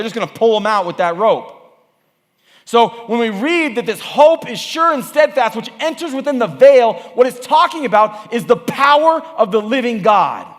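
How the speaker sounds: emphatic pulpit proclamation; preaching at full force. Real, not acted. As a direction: preach it high and loud, punching the key words.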